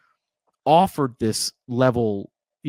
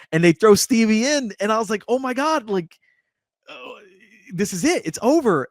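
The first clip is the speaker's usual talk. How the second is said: much higher, tense disbelief at the scene; shocked retelling; 10 semitones higher, 4 dB louder than usual. Retold or imitated. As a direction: replay the horror of watching, pitched way up.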